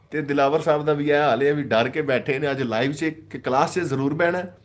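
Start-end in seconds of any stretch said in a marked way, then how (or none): none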